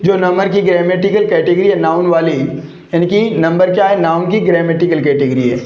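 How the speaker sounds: ordinary delivery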